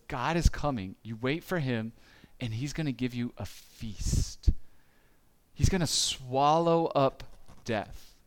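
ordinary talk

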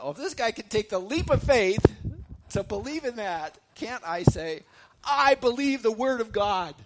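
high, loud preacher's shout; ringing shouted profession of faith; fervent declaration. Acted. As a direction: proclaim it at full voice, building to the last line.

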